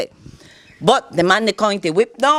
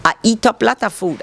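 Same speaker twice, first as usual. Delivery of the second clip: normal talking voice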